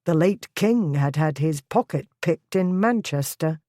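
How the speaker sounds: plain reading voice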